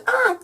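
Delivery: short high-pitched squeal; excited squeal. Retold or imitated. Girl retelling her bus crush chat, excited squeal. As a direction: let out a quick, high "ah!". Excited reaction when he keeps talking.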